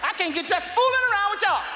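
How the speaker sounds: very high, flustered scolding; frazzled mama. Retold or imitated. Mother blaming the kids for making her late, very high. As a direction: complain high and frantic.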